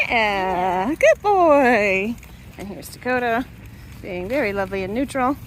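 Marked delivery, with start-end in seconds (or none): 0.1-2.1 talking to a dog. Real, not acted. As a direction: cheer the dog on. Praising dog during training.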